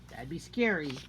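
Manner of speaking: plain reading voice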